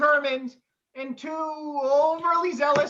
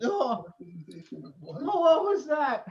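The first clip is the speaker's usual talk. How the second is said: usual speaking voice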